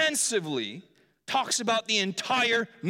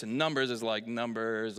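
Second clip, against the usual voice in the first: ordinary delivery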